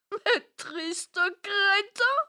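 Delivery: very high, loud, slow insults; a shrieking rage; furious yell. Acted. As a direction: scream abuse at the useless servants. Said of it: "hurla-t-elle".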